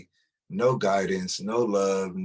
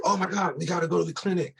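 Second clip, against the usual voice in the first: high, urgent mock panic; rushing like he's already late; panicked; 10 semitones higher than usual. Friend panics seeing the time, high and hurried.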